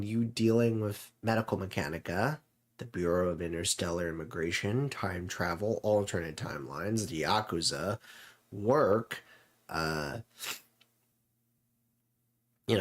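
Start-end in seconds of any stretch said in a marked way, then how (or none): none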